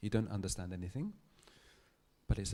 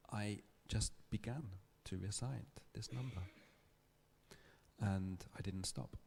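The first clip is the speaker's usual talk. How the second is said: ordinary talk, nothing marked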